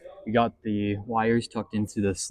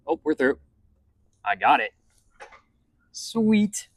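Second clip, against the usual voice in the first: excited; 5 semitones higher, 3 dB louder, slower than usual. Real, not acted. Wire finally through firewall.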